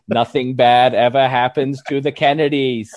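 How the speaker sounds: loud, hammed-up cartoon Kennedy; JFK clone shouting. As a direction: shout it like a cartoon politician, half laughing. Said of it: "where the clone of J F K shouts".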